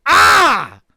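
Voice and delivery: sudden loud, high "Ah!"; startled pained yelp. Acted. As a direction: yelp as if just slapped. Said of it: "his head immediately snaps forward and he goes".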